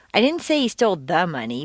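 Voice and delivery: "the" emphasized, said in full before "money"; stress on THE. Shown as a demonstration. Teacher implying some other money, stress on "the". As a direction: punch "the" to single out that money. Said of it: "but rather some other money".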